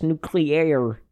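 "nuclear" as noo-klee-AIR; careful pronunciation. Shown as a demonstration. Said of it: "That's how you pronounce it correctly".

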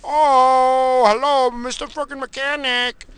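high, drawn-out put-on greeting; mocking scientist voice. Scientist greeting the visiting mechanic, mockingly imitated. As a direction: mimic a scientist in a high mock voice.

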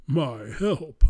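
put-on big old whale answering from the dark; whale's voice. A narrator's reading. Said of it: "a voice came back".

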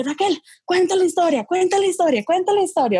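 high-pitched imitation of a pleading friend; imitated party nagging, pitched well up; eager urging.

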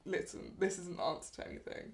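soft whisper, gently urging; whispered plea. Retold or imitated. Friend softly pleading with crying Van to go home.

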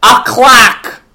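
high, loud scoff at A clock; mock outrage. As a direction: sneer A clock! loudly. Mocking the Tin Man's gift.

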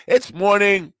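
big bright show opener; announcer voice. Acted. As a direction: announce it like morning radio. Mock show intro announcement.

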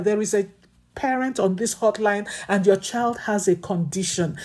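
ordinary talk, nothing marked

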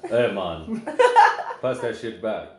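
put-on low, laid-back Jamaican accent. Asking a friend for the blunt back, Jamaican accent. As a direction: ask casually in a put-on Jamaican. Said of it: "and you want it back".

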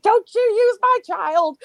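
high, loud outraged scream; mother's protective shriek, retold. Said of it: "I screamed".

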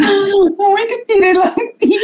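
high, slow, through laughter; can't stop giggling; laughing. Real, not acted. Amiga ri do apelido. (rindo muito, agudo)

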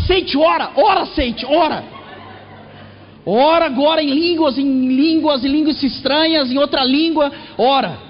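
frantic mother. Retold or imitated. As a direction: voice the panicked mother begging him to pray. Mother urging son to pray.

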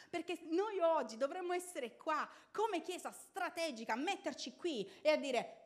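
high, loud fiery sermon; preaching at full force. Real, not acted. Preacher rallies the congregation against spirits: fiery preaching. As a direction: preach it out to the whole church.

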